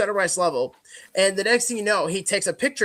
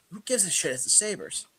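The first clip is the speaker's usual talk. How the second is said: softer throwaway retort; dismissive shrug; 7 dB quieter than usual. Retold or imitated. Husband reassuring wife about lost sign, dismissively. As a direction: toss it off quietly, unbothered.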